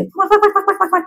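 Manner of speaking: high rapid quacking babble; mock yapping in a high voice; imitated nagging chatter. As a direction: rattle off nonsense like a nag. Imitating a husband's angry chatter.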